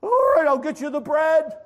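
high, loud yell; worn-down, hollered concession; exasperated giving in. Acted. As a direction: shout your surrender through the door. Neighbor finally gives in, yelling.

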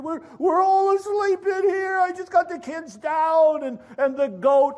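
high, loud exasperated complaint; acted exasperation at full voice; frustrated. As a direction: holler through the door, fed up. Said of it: "he's getting a bit frustrated".

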